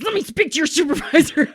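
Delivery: high, laughing entitled demand; laughing mock Karen. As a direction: laugh while mocking her. Retelling demanding caller, laughing.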